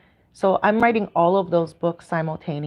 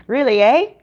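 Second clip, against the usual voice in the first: louder, higher Canadian "eh" that lifts at the end; playful Canadian accent; 7 semitones higher, 7 dB louder than usual. Pretend. Streamer answering a remark on her accent, playful Canadian lilt. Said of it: "My Canadian accent pops out".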